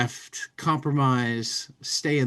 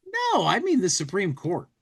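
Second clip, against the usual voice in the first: punchline voice; 8 semitones higher than usual. Acted. Punchline in comic bubble.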